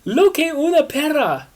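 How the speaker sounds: high, loud hammed-up cry; cried out. Will cries out at Christina, loud and high. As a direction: wail it out. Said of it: "Will cried".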